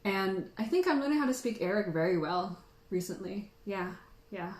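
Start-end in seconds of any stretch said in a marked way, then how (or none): none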